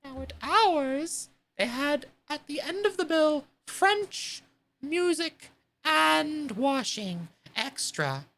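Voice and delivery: high, slow, mournful sea creature; Mock Turtle. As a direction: stretch each word like a sad old turtle.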